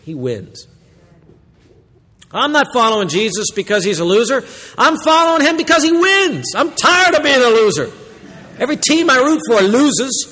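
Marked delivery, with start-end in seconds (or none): 2.3-10.3 much higher and louder, half laughing; fired-up rant. Real, not acted. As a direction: get worked up about losing. Preacher rails about always losing.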